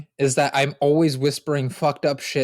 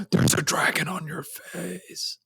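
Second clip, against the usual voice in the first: slow, spooky whisper; awed whisper; 4 semitones higher, 5 dB quieter, slower than usual. Acted. Tripping friend blurts what he sees, slow whisper. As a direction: whisper it slowly, as if seeing things.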